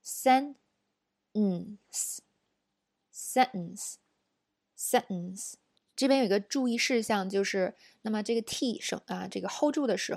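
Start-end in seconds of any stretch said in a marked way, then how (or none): 0.0-5.5 very slow sentence, T held before the nasal. Shown as a demonstration. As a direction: draw out sentence, no T burst. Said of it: "我们要怎么读呢".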